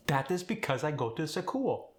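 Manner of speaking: put-on Khmer-accented reply, extra vowel in school.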